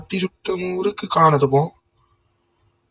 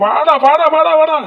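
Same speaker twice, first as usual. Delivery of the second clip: high, loud impatient calls; excited taunting call; 11 semitones higher, 4 dB louder than usual. Real, not acted. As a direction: goad the enemy out. Calling enemy to come.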